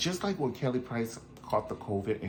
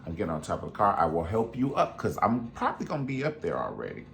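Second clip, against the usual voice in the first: ordinary talk, nothing marked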